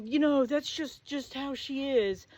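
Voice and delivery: slow mock-whiny boyfriend excuse. Imitating the boyfriend defending his cousin, slow and mocking. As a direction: drag out his lame excuse.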